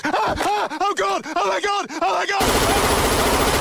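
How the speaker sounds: high-pitched, repeated shrieks; horrified screaming. Acted. Snooper seeing the magazine, horrified. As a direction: shriek in mounting horror, stretching the last cry.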